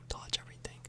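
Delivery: normal talking voice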